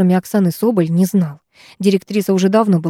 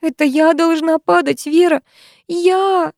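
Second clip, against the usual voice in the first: high anguished sob; 8 semitones higher, slower than usual. A narrator's reading. Lyusya sobbing, holding Vera.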